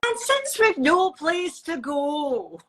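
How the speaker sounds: slow Scottish lilt; sing-song Scottish teaching line; Scottish accent. Shown as a demonstration. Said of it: "in a Scottish accent".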